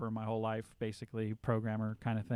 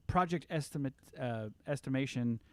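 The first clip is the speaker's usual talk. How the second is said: ordinary talk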